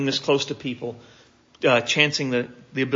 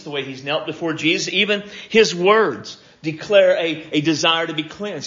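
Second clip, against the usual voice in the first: usual speaking voice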